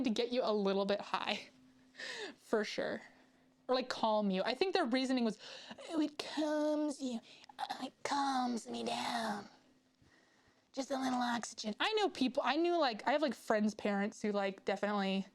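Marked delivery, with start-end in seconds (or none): 5.9-11.7 slow soothing whisper. Retold or imitated. Mocking the oxygen bar pitch.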